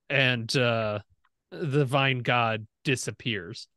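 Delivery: ordinary talk, nothing marked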